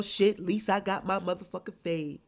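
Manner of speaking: ordinary talk, nothing marked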